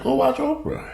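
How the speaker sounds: high mocking squeak on Oprah. Real, not acted. Telling critics to go watch Oprah, mocking high voice. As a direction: sneer it up high.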